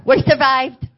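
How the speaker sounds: high, loud cheer of relief; triumphant cheer. Real, not acted. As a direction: celebrate it out loud. Triumphant ending of the story.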